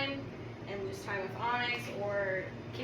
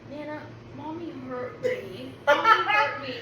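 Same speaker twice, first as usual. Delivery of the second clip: put-on slow whiny kid voice, then laughing; mock whine; 4 semitones higher, 7 dB louder, slower than usual. Mom imitating son tattling to Nana, slow whine. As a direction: imitate the fake whine cry. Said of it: "he's going".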